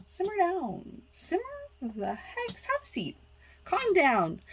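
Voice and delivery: high, soft, mock-soothing telling-off; hushing scolder. Real, not acted. Host telling the ranting seller to settle, hushed scolding. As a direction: shush them in a small high voice.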